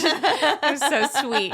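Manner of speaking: high, loud, drawn-out laughing words; affectionate laughing. Real, not acted. Teasing a tender-hearted friend, affectionate laughing. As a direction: gush it through a real laugh.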